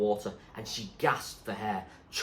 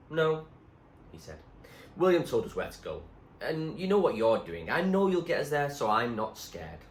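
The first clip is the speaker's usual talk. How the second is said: Munchkin reassuring; 5 semitones higher, 4 dB louder than usual. A narrator's reading.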